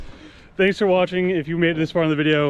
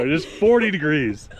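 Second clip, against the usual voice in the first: usual speaking voice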